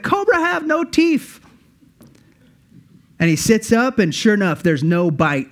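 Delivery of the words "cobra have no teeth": very high, slow, put-on Indian accent; snake charmer accent. Charmer tells the bitten tourist, high slow Indian accent. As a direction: drawl it out like an amused local charmer. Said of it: "He's like".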